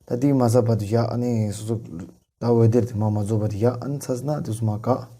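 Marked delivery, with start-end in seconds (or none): none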